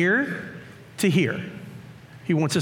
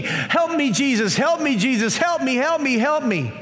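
mock screaming plea; 8 semitones higher than usual. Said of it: "He's just screaming".

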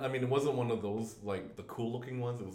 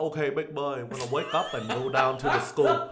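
louder, put-on Vietnamese-accented parent; 2 semitones higher, 7 dB louder than usual. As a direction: rouse the kid in a mock Vietnamese accent. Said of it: "talk to my kids with a Vietnamese accent".

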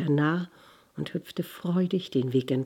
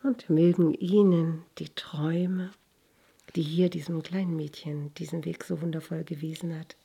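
whispered blessing. Real, not acted. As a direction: whisper a gentle blessing to the listener. Closing blessing to listeners.

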